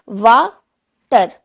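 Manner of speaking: "वाटर" very slowly in two loud syllables; "वाटर" stretched as वा... टर. Shown as a demonstration. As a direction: split "वाटर" into वा and टर, each drawn out. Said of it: "इसमें कितने syllables है".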